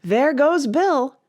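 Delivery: loud, slow, high crowd cry; chorus shout. A narrator's reading. Animals cheer as Bill flies out, loud chorus. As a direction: shout it out together. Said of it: "a general chorus of".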